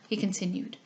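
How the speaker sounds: ordinary delivery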